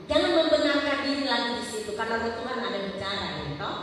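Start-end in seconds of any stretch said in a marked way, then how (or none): none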